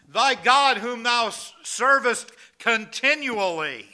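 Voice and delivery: loud, slow, high, every word weighed; booming royal proclamation. Acted. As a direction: proclaim it like a king over the den. King addresses Daniel in den.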